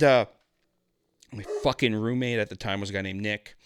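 normal talking voice

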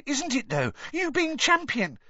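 very high, gushing squeak; eager little boy's piping voice; excited schoolboy. A narrator's reading. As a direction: gush to your hero. Colin keeps gushing.

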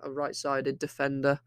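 ordinary talk

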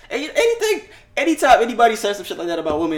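words spilling out through laughter; laughing. Real, not acted. Laughing while making the point.

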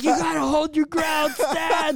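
high, hammed-up heroic urging; rally a falling comrade, mock dramatic; movie-style rallying cry. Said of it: "on some fucking movie shit".

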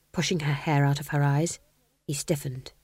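plain reading voice

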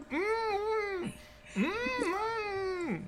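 very high closed-mouth whine; muffled panicked humming. Acted. Player with lips stuck to hers, panicked muffled whining. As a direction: signal trouble through stuck lips. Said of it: "I'll try to panic".

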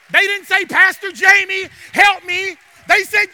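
loud, high, punched phrases; full-voiced pulpit delivery; preaching at full force. Real, not acted. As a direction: hammer the contrast home.

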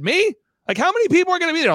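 high, rapid-fire questioning; startled disbelief. Retold or imitated. Retelling his reaction to a surprise invitation, startled. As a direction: blurt it out high and fast, incredulous.

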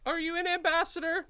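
high-pitched, awkward nervous questioner; Philip. Acted. Nervous man asks a stranger his role, high and awkward. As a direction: pitch it way up, politely unsure.

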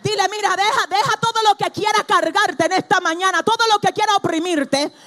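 loud, high, driving sermon voice; a fiery preacher rousing the congregation; full-force preaching. Acted. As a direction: preach it hard to the whole room.

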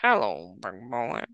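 low, soft come-hither purr; breathy vintage purr. Retold or imitated. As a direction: purr it like an old film siren. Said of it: "Mae West looking".

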